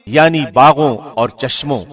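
ordinary talk, nothing marked

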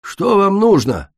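high, loud and slow gloomy demand; sullen question. A narrator's reading. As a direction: ask grimly, clearly annoyed. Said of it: "хмуро спросила". (хмурый медленный вопрос)

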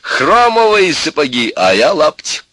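loud, high, slow boasting tirade; in full swing. A narrator's reading. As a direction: hold forth at full volume, worked up. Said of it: "вовсю разошёлся Максимыч".

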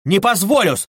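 furious shout. Acted. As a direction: slam it out like a fist on the table. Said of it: "крикнул он вдруг".

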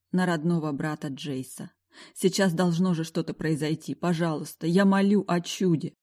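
plain reading voice

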